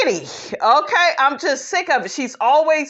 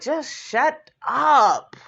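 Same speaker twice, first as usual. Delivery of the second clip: exasperated; 3 semitones higher, slower than usual. Retold or imitated. Retold exasperated reaction to Mayetta.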